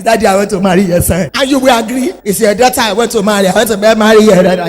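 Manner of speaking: loud, fast mock suitor voice; cheeky suitor boy. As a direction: ham up the brash boy asking for the daughter. Preacher acts cheeky suitor boy.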